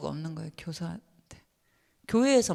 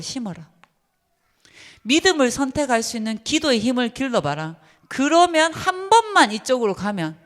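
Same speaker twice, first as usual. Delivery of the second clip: enthusiastic praise; 4 semitones higher, 6 dB louder than usual. Retold or imitated. Teacher praising a student.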